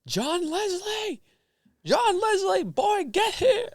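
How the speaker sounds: high, slow, drawn-out yell imitating Les Brown. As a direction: call the son across the house, very high and stretched. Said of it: "he just starts yelling out to his son".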